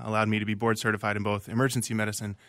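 ordinary talk

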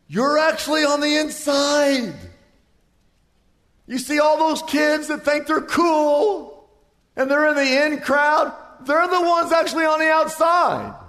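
high, loud, stretched preaching cry; preaching at full force. Acted. Preacher rousing the congregation about calm in the storm, full force.

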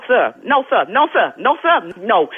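ordinary delivery

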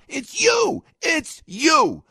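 a high, loud jab; accusing shout. Real, not acted. Host accusing Trump haters, shouting. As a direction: throw the blame straight at them.

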